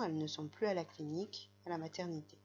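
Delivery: normal talking voice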